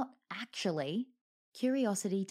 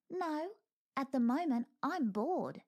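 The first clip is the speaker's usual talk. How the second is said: ferret voice; 8 semitones higher than usual. Acted. Ferret taunting the wolf.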